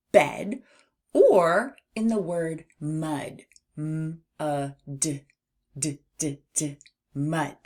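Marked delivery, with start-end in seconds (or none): 3.8-6.8 MUD split into separate sounds, D repeated short; sounded out letter by letter. Shown as a demonstration. Teacher showing children the end sound, segmented phonics. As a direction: sound out M, U, D with gaps, then bounce on D. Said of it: "We can hear that D sound at the end".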